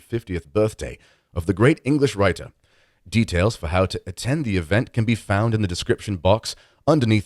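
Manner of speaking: British news anchor's promo read; British TV accent. Shown as a demonstration. Host reading a conference promo to listeners, British TV accent. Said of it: "read a promo for the conference with a British accent".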